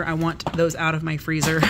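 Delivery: usual speaking voice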